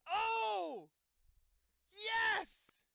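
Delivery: shrieking, very high and loud; ecstatic screaming. Real, not acted. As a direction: scream with pure joy. Still screaming with joy.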